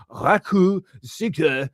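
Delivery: put-on Japanese accent, hammed up; amateur mock-Japanese delivery of foreign words; attempted Japanese accent. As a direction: have a rough go at a Japanese accent. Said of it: "my best attempt of a Japanese accent".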